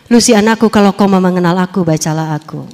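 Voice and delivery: God's voice, lightly voiced; a hint of God's voice. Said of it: "ada suara lagi".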